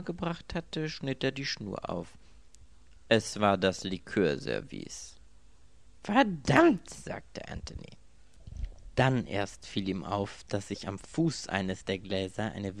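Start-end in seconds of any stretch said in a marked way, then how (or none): 6.0-6.9 viel höher und laut ausgestoßen; laut fluchen. A narrator's reading. Anthony flucht überrascht. (lauter überraschter Fluch)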